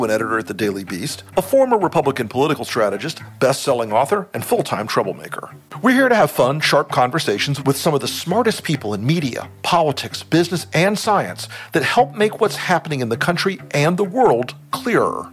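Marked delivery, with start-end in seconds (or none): 5.8-15.3 high, energetic host intro; show intro. Acted. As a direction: pump up the show opening. Podcast intro host read.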